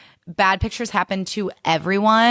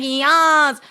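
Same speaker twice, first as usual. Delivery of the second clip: mock yas; 7 semitones higher, 4 dB louder than usual. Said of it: "They're not supposed to be like".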